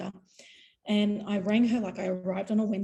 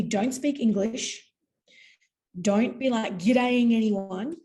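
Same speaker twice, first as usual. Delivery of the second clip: slow instructions; 4 dB louder, slower than usual. Retold or imitated. Cousin's instructions.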